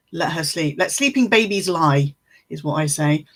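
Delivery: ordinary talk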